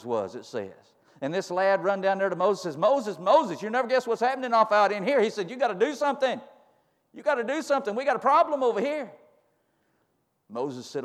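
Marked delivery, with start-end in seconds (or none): 2.8-5.2 urgent. Retold or imitated. Lad runs to alarm Moses. 5.5-9.1 urgent. Retold or imitated. Lad runs to alarm Moses.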